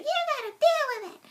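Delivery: put-on high, loud little-kid yell; mock child tantrum shout; child Korra. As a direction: shout it like a bratty four-year-old. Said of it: "where she's like".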